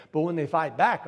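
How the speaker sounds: ordinary talk, nothing marked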